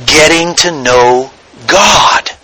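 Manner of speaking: ordinary talk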